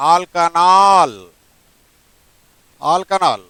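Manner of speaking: stretched out very slowly; slow dictation. Shown as a demonstration. Teacher dictating a name to the student, very slow. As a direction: draw the word out for writing. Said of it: "teacher dictating names to student".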